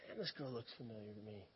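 low, soft inner thought; hushed aside to yourself; thinking to himself. Retold or imitated. Retold private thought to himself.

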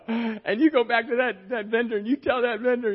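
fired up, pushing him to act now; excited friend. Retold or imitated. Friend urging him to confront the president, excited. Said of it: "I mean, he got excited".

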